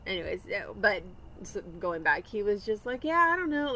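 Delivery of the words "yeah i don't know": 무심하게; blasé. Retold or imitated. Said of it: "real, you know, blasé about it".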